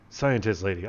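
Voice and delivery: ordinary talk, nothing marked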